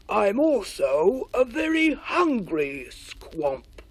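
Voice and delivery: slow, loud creature voice; hungry squamp voice. A narrator's reading. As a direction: savour the hunger slowly. The squamp speaks.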